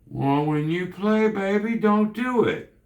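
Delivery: high mimicking scolding, climbing at the end; mock a preachy advice-giver; mock critic. Continues mocking a critic.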